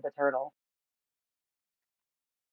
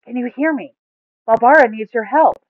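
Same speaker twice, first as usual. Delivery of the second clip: higher, louder calling; 8 semitones higher, 10 dB louder than usual. A narrator's reading. Buma calling into the mud for Gar, louder. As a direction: call again louder. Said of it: "louder this time".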